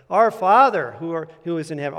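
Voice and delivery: "Father" swelling out above the other words; stress on Father. Shown as a demonstration. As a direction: hit the word "Father" hard, then ease off. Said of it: "emphasizing a different word each time".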